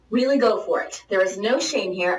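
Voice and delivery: ordinary delivery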